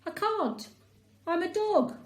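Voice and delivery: a hint of cheeky dog; unhelpful. A narrator's reading. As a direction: state the obvious, no help at all. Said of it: "replies Mutt unhelpfully".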